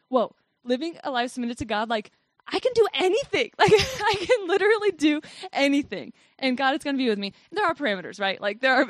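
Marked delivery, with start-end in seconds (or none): 2.5-6.1 excited. Real, not acted. Excited realization about freedom in God.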